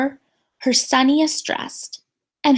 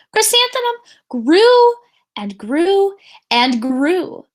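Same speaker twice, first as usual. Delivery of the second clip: building growth; 6 semitones higher, 5 dB louder than usual. A narrator's reading. Reader stretches growing name.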